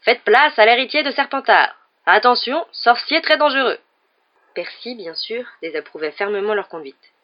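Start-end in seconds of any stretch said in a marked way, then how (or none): 0.0-3.8 loud, high mock announcement; mock shouting. A narrator's reading. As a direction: herald him jokingly down the corridor. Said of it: "en criant".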